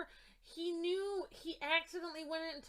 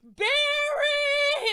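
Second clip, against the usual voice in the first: high, drawn-out yell of a name; full-voiced, stretched-out cry; shouting; 13 semitones higher, 15 dB louder than usual. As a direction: imitate a desperate shout across a battlefield. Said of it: "Cyborg is sitting here shouting".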